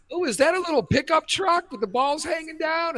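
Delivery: put-on high, innocent kid question; high-pitched curious child asking; daughter's question. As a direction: play the wide-eyed little girl. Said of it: "And the daughter goes".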